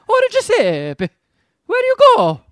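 high, loud mock-Italian accent. Kid herding fish underwater calls after one, exaggerated Italian accent. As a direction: wail it in a hammed-up Italian accent. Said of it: "only time he has an Italian accent".

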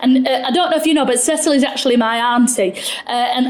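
ordinary talk, nothing marked

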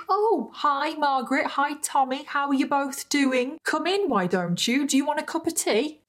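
high, sugary mock-welcoming voice; fake charming James. James mockingly greets Kelly's angry parents at the door, high and sickly sweet. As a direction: greet them like a too-sweet host you can't trust. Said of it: "he's like".

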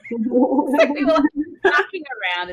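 breaking up with laughter while telling it; laughing. Real, not acted. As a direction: laugh through the words. Laughing recalling joking with patient.

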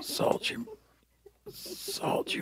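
high unvoiced ghostly whisper; soft echoing dream voice. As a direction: fade away like a dying spirit. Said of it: "can you do it as you die for me".

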